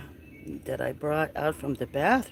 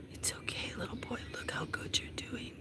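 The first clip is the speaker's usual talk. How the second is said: soothing whisper; calm the kitten with a whisper; 7 semitones higher, 12 dB quieter than usual. Real, not acted. Owner soothing a frightened feral kitten, whisper.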